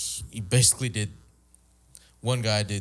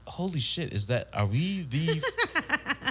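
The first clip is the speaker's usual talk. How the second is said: shocked; 9 semitones higher, slower than usual. Retold or imitated. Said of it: "I'm like".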